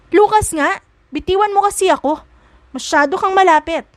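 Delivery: high, loud exasperated snap; snapping at him. A narrator's reading. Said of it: "Singhal ni Isabela".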